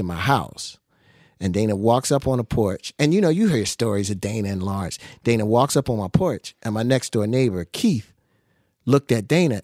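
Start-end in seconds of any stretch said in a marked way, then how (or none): none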